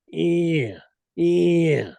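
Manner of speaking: high, throaty elk mew sounds voiced from the throat; throaty cow mew demo. Shown as a demonstration. Hunter demonstrating the throaty estrus buzz, a high throaty mew. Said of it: "with your throat, you're just kinda going".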